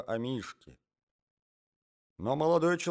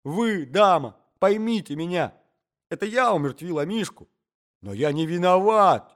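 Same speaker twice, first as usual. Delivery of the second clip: loud, raised, pitiful appeal; pleading; 5 semitones higher, 8 dB louder than usual. A narrator's reading. Intruder imploring the young lady to understand, pleading. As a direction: protest innocence, self-pitying. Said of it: "складывая руки и обращаясь к молодой даме".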